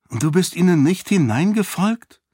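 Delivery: high-pitched, let-down question; disappointed. A narrator's reading. Lady learns her Seon did not follow, disappointed. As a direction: ask with open disappointment. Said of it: "fragte sie enttäuscht".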